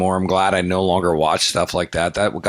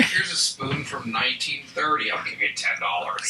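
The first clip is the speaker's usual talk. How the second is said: breathy whispered lowball offer, dropping away at the end; mock whisper; 3 semitones higher, 8 dB quieter than usual. Friend jokingly imitating the pawn dealer's lowball offer, whispered. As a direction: murmur the cheap offer like a secret aside.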